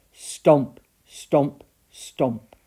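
loud heavy thudding words; booming stomps. A narrator's reading. Narrator voicing the giant's footsteps, loud and heavy. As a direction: land each stomp like a footstep.

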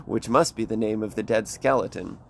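ordinary talk

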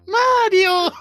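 high shouted "Mario!" cry; shouted Mario call. Retold or imitated. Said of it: "salió Shigeru Miyamoto y dice".

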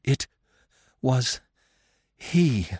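broken up word by word, as if winded; between gasps. A narrator's reading. Winded mother answering her fawn, between gasps. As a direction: gasp it out in single words. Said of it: "His mother answered between gasps".

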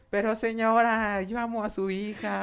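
high, slow, whiny begging; hammed-up lovesick plea to the mother; mock pleading. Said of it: "Y yo ahí".

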